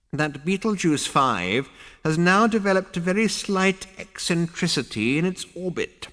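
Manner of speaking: a creaky ancestor drawing out the name. A narrator's reading. Ghost ancestor speaks.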